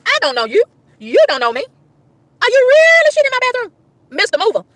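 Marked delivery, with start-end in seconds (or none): 2.4-4.6 high-pitched wail of disbelief; incredulous outrage. Retold or imitated. Woman confronting mover over bathroom, incredulous. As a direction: demand it in a high, appalled voice.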